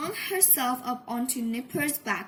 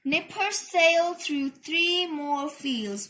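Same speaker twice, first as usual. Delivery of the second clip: ordinary delivery, nothing marked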